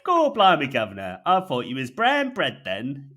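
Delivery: loud, high Cockney interjection; hammed-up Cockney barrow boy. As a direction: chirp it like a cheeky Cockney geezer. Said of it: "interjects the pooper scooper".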